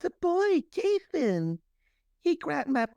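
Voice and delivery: extremely high mock Southern belle; hammed-up high-pitched damsel recounting an attack; [name]. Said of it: "A Southern Belle from".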